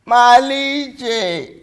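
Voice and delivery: loud, drawn-out calling of the name; stretched syllables. Acted. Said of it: "She stretched each word, each syllables".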